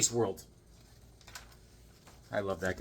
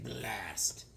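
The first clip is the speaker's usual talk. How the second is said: whispered blast; 4 semitones lower, 12 dB quieter than usual. Retold or imitated. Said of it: "He's like".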